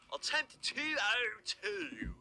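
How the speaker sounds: high, drawn-out mock British accent; overdone British accent; obnoxious British accent. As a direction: slowly ham up an over-the-top British accent. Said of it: "my extremely obnoxious British accent".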